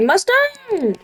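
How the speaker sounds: high, drawn-out mock holler; imitated high-pitched cry; mock meme yell. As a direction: stretch it out high, then let it fall. Mimicking a drawn-out meme shout.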